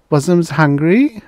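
put-on high, slow, loud baby-talk coo; drawn-out, high-pitched doting question. A narrator's reading. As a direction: coo it as if to an infant. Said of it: "Molly cooed".

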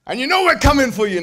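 loud, high-pitched joking threat; mock threat. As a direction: belt out a playful warning. Mock threat, then only joking.

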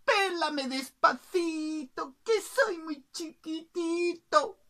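high, slow, drawn-out plea; pleading little bird. Acted. Bird begs the cook to pluck gently, high slow plea. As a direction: stretch the plea in a high singsong. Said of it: "el pájaro dijo".

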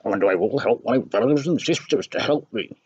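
high, slowed, odd little fish voice, comforting; talking fish. Acted. Fish answers.

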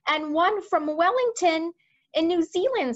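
army chief, urgent. Acted. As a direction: report alarming news to the monarch. Voices head of army.

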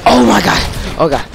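sudden loud scared cry; panicked shout. Real, not acted. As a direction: yelp in real fright. Spider attack, panicked cry.

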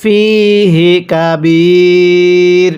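ফিহি কাবীর drawn out slowly, pausing on কাবীর; slow recitation stop. Shown as a demonstration. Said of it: "আমার সাথে পড়বেন". (ধীরে ওয়াকফ করে ফিহি কাবীর)

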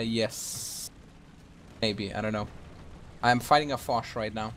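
usual speaking voice

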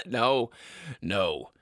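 a dad gruffly reassuring his wife; gruff father. Acted. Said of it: "worrying my parents".

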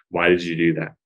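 falling tune, interrogating question. Shown as a demonstration. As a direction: ask it flat and falling like an interrogation. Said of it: "Down tone, right?".

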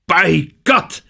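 high, loud roar. A narrator's reading. Denham roaring at the sailors, high and loud. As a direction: bellow it out in triumph. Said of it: "brüllte er".

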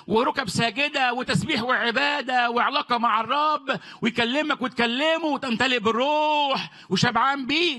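high-pitched, fervent sermon voice, driving the point home; fiery preaching. Acted. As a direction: preach it out to the congregation. Preaching at full force.

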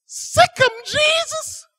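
very high, loud squawk; piercing parrot voice. Acted. Parrot sets the guard dog on the robber, high and piercing. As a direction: shriek the command as a tiny bird. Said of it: "the bird said".

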